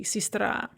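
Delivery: "sistra" with first vowel ee, stress on final a; unstressed ye as "e". Shown as a demonstration. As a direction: say "sistra" like sees-TRA. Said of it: "ye is pronounced as an e".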